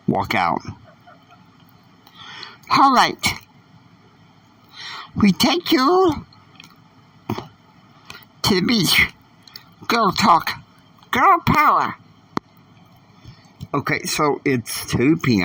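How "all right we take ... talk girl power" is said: put-on much higher, bright-eyed teen girl voice. A narrator's reading. Catalina inviting Annie to the beach, high girlish voice.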